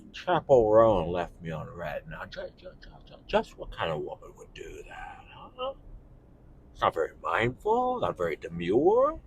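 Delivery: put-on stammering whisper of an old film star; chronically online Jimmy Stewart. Shown as a demonstration. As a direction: complain in a hushed, halting old-Hollywood voice. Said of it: "chronically online Jimmy Stewart".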